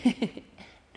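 ordinary delivery

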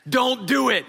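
firm, spaced-out parental warning; stern warning. Retold or imitated. Dad warns daughter not to foul back, stern. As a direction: lay down the law to a kid. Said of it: "I look at her and I go".

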